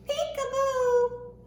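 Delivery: high, slow, sing-song peekaboo; playful peekaboo. Acted. Playing peekaboo with the children, high and playful. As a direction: surprise the little ones. Hugging poem game with children.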